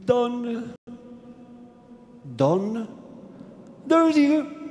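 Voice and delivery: the priest forced to blurt the name, syllables tumbling; forced, hurried stammer. Acted. Priest forced to name the tyrant, stammering it out in haste. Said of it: "Pronunziò in fretta il forzato".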